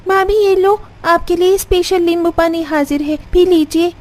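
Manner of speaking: put-on high, perky young woman's voice; high-voiced and chirpy; young sister-in-law. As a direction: play the eager sister-in-law, pitched high.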